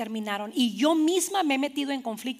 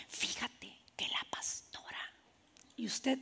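gossipy whisper; 15 dB quieter than usual. Acted. Church member gossiping about the pastor, hushed whisper.

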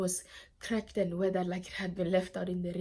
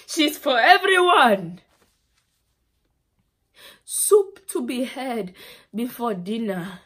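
captor's loud announcement; 3 semitones higher, 13 dB louder than usual. Acted. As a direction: call it out to the whole camp. Quotes a captor offering her around.

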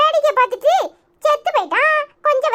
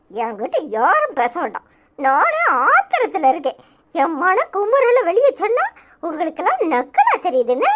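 put-on lowered voice of a grumbling old grandmother; sulking grandmother; 7 semitones lower than usual. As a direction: lower sulky old woman's voice.

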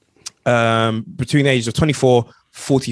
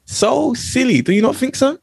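higher, mock-stern tellingoff; mock scolding interviewer; 9 semitones higher, slower than usual. Host quizzing a mock gang member, higher mock scolding. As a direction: scold him like a disapproving reporter.

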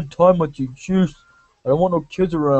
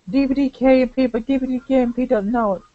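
put-on kid voice; 8 semitones higher than usual. Voices a character.

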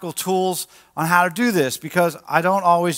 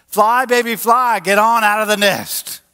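raised, higher cheering-on call; urging call; 4 semitones higher, 4 dB louder than usual. Acted. Parent sends grown kid from the nest, acted urging call. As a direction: call it out like a parent pushing a fledgling.

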